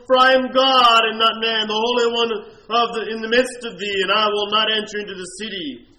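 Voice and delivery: preacher thundering the verse; preaching at full force. Acted. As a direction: proclaim it like a lion's roar.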